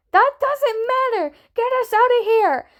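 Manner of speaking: high, loud screamed demand. A narrator's reading. Tommy begs Wilbur for rescue, screaming. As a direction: scream to be pulled out. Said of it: "Tommy screamed".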